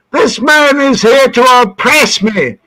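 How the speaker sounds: high, declaimed accusation; son's protest. Retold or imitated. Son accuses cop in front of dad, declaimed protest. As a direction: proclaim the grievance as the indignant son. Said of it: "And my son said".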